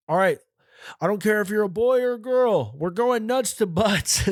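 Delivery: high, stretched-out mock officer command; put-on high officer voice; corrections officer. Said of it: "the corrections officer going".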